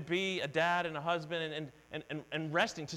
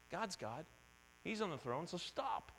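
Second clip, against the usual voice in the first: much softer, calm answer to the worry; quiet reassurance; 9 dB quieter than usual. Real, not acted. Preacher answering his own worry, soft and calm. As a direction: settle yourself down gently. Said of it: "quiet self-reassurance".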